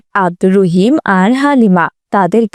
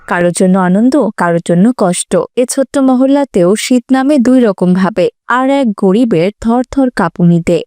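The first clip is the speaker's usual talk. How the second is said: usual speaking voice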